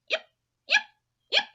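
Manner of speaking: light little yips like a small dog; puppy yips. Acted. Teaching puppies, a small yipping dog. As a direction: yip it out like a tiny puppy.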